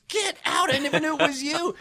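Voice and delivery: very high, astonished exclamation; amazed. Retold or imitated. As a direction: blurt it out floored. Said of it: "he goes".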